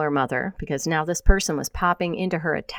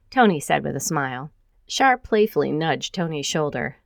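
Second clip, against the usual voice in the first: usual speaking voice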